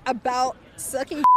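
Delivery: usual speaking voice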